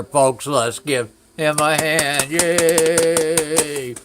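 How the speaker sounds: loud, high, slow host cheer; rally the crowd like a show host; announcer cheer. Pretend. Hosting cheer for outdoor shower.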